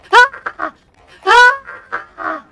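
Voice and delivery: short high yelps of fright; scared scream. Real, not acted. Jump scare in the game. (kurzer Angstschrei)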